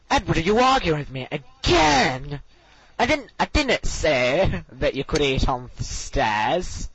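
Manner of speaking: drawn-out scolding teacher; exaggerated teacher accent; Miss Hines impression. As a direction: mimic a teacher's exasperated telling-off, stretching key words. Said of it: "Okay, Miss Hines, right".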